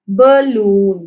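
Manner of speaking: Balloon drawn out as ba, loon; syllable by syllable. Shown as a demonstration. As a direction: split balloon into two clear syllables. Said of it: "टू syllables हैं".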